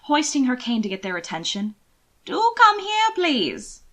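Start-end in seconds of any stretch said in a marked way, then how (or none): 2.2-3.8 much higher, louder beckoning call; trilling old aunt. A narrator's reading. Aunt Margaret waving her cane at them, high trilling call. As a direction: call them over with a sing-song lilt. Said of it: "she trilled".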